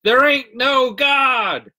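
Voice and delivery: loud, high, drawn-out holler; shouted retort. A narrator's reading. Godless neighbor answers woman on porch, loud hollered retort. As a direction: holler it back slowly, stretching every word. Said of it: "her godless neighbor to response".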